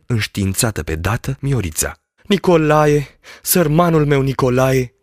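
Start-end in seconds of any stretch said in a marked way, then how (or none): 2.2-4.9 put-on louder, higher cry of the girl; anguished cry. A narrator's reading. Girl running to her injured fiancé, crying out. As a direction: call out his name in distress. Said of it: "strigă fata".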